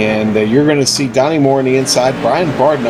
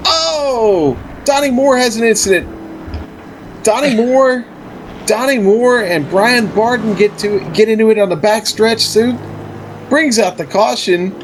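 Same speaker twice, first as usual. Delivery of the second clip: high, urgent play-by-play; excited incident call; 9 semitones higher than usual. Acted. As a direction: sound the alarm on a wreck.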